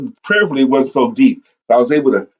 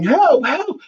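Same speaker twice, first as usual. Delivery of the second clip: high-pitched mock yell for help; imitated yelling. Soldier lost in the thicket calling out, imitated yelling. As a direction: re-enact a call for help, pitched high without full volume. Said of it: "And I'm yelling".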